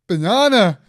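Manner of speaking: high, very loud blurted shout; brother's shout. Retold or imitated. Brother seeing mum's yellow hair, loud mocking shout. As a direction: yell it out like a cheeky punchline. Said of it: "he went this, he goes".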